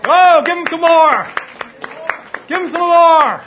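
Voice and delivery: fervent shout to the crowd; loud urging cry. Real, not acted. Urging congregation to praise.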